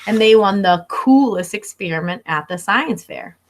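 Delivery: usual speaking voice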